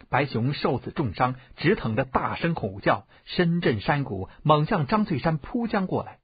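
ordinary delivery, nothing marked

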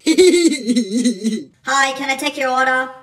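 low, lightly voiced cashier; drive-through worker. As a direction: play a bored fast-food worker.

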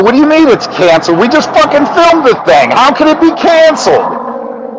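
high, fast incredulous outburst; shocked disbelief. Retold or imitated. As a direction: react like the rug was pulled out. Stunned protest at cancellation.

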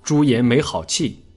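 usual speaking voice

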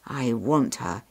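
low-pitched ogre's private thought; low, deliberate young ogre; scheming ogre. A narrator's reading. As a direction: think aloud as a covetous ogre. Clever young ogre thinks of girl.

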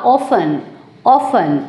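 often with a silent t, OFF-en; British pronunciation. Shown as a demonstration. Teacher demonstrating the British often, t silent. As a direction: drop the t in often, British style. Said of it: "British pronunciation होगा".

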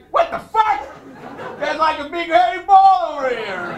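loud, high shocked astronaut cry; a startled astronaut yelling in disbelief. Pretend. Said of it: "the astronauts would look like".

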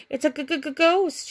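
slow, high, stuttering scared cry; stammering fright. A narrator's reading. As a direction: stutter it out in terror. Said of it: "she cried".

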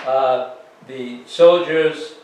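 normal talking voice

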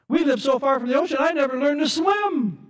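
high, fast, shouted panic; panicked cry. Acted. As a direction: yell it like someone about to drown. Drowning scoffer cries out.

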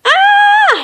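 sharp high yelp of fright; a jump-out-of-your-skin yelp; startled high cry. Acted. Mister Surprise yelping at Mister Lazy's boo. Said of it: "he cried".

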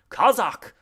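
high, loud call of command; sharp cry. A narrator's reading. Man commanding his dog in the dark, loud cry. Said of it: "cried a man in the dark".

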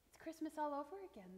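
plain reading voice

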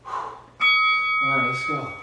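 usual speaking voice

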